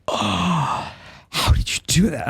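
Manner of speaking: amazed gasp. Retold or imitated. Amazed teacher, retold.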